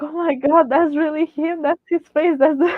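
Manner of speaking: high-pitched, breathless-with-excitement retelling; re-enacted fangirl disbelief; starstruck excitement. Reliving first sight of the star.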